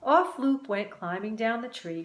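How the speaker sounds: ordinary delivery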